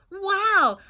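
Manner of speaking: plain reading voice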